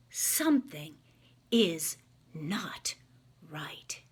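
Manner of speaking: hushed storybook whisper; whispered suspense. A narrator's reading. Reading a bedtime story, whispering the night scene. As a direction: breathe it like a secret in the dark.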